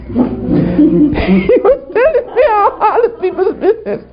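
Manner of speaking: high-pitched, helpless laughing voice. Real, not acted. Preacher confessing the devil's gossip, real laughter in the voice. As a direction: laugh right through the sentence.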